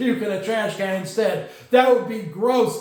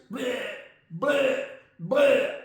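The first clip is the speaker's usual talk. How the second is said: mock retching bleh; 4 dB quieter, much slower than usual. Corky the Crocodile puppet retching.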